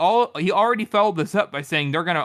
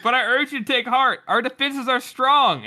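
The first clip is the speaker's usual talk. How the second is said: rousing speech; 7 semitones higher than usual. Retold or imitated. King's rallying speech.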